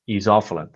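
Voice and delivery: Isovalent as iz-OFF-uh-lent; unsure pronunciation. Shown as a demonstration. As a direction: say Isovalent with a short iz start and stress on OFF. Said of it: "Do I pronounce it correctly?".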